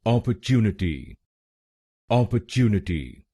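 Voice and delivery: Opportunity with the "chyoo" syllable stressed; stress on third syllable. Shown as a demonstration. Teacher showing the stressed third syllable. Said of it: "TU पर जोर डालना है".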